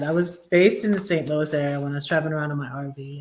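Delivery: ordinary talk, nothing marked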